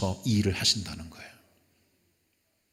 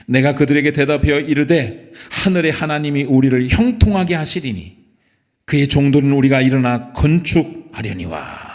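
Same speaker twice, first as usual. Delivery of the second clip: loud, declamatory Bible reading; forceful pulpit reading; scripture reading; 2 semitones higher, 8 dB louder than usual. Acted. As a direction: proclaim the verse firmly to the congregation. Reads scripture aloud to congregation.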